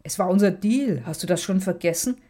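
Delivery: ordinary delivery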